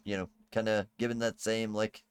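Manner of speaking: usual speaking voice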